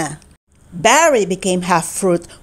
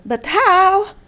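surprised; 9 semitones higher than usual. Acted. Firex puzzled.

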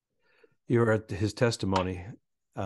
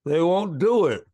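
loud, high, drawn-out punchline delivery; stand-up delivery; 7 semitones higher, 4 dB louder than usual. Acted. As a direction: belt out the joke like a comic working a noisy room. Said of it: "And you're going".

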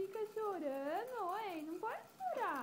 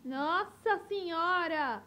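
loud amazed exclamation; loud surprise; 10 dB louder than usual. Real, not acted. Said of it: "Que voz, hein?". (espanto alto com o grito do bebê)

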